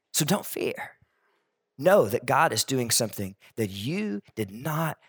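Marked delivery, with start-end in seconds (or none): 0.1-1.0 soft unvoiced "So don't fear"; whispered reassurance. Real, not acted. Preacher reassuring the congregation, hushed whisper. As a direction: breathe it like a secret comfort.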